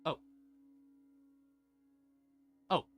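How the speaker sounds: ordinary delivery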